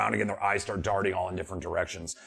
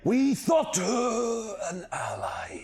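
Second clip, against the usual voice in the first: slow, higher eerie voice; slow bird spirit; 10 semitones higher, much slower than usual. Acted. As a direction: speak like an old bird.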